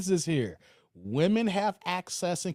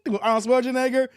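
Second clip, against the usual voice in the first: ordinary talk, nothing marked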